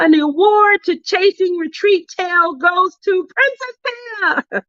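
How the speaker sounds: high hammed-up announcer; mock award announcer.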